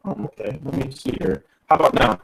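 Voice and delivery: crackly, staticky phone line; distorted over a bad line; staticky connection. Real, not acted. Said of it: "it still sounds a little bit, uh, staticky".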